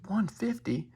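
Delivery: high, indignant imitation of a woman; mock mad lady. Lady reacting to the agreed price, mock mad. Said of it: "She's mad".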